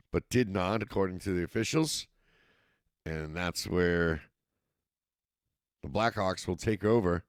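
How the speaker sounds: steady live football call, rising with the action; play-by-play commentary. Acted. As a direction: call the game as it happens.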